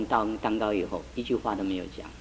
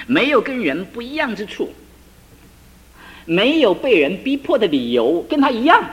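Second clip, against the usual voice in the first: rising, louder sermon cry; impassioned preacher climbing higher; preaching at full force; 6 semitones higher, 5 dB louder than usual. Real, not acted. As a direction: rebuke the church passionately.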